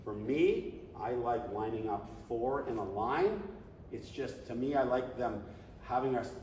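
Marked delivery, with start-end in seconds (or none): none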